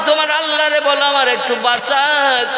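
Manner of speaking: high pleading cry; pleading Pharaoh. Acted. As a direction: beg for rescue, pitched high. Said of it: "আবার ফেরাউন বলে". (উঁচু গলায় কাতর অনুনয়)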